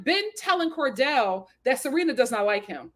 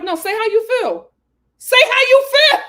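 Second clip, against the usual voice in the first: insistent urging; 9 semitones higher, 8 dB louder than usual. Retold or imitated. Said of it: "she was like".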